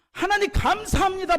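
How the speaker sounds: high-pitched, lifted cry of thanks; imitating a fervent prayer cry. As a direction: call out to God in praise. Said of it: "부르짖으면 얼마나 좋아요".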